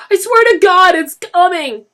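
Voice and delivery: put-on a terrified boy pleading. A narrator's reading. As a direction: beg for your life. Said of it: "Denny pleaded".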